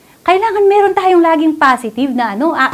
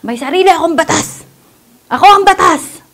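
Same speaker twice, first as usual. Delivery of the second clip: a proud voice that rises louder and higher; haughty boast; 4 semitones higher, 3 dB louder than usual. Retold or imitated. Preacher mimics an insolent person boasting to the flock. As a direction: boast like the law is yours. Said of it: "yung style niya".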